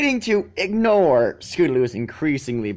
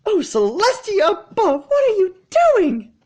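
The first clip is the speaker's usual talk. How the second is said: shriek; 6 dB louder than usual. Acted. Frightened unicorn seeing fillies by the dragon, shrieking. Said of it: "The shriek came from".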